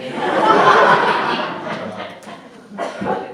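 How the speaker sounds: long airy laughter; real laugh, breathy. Real, not acted. Laughs at own joke.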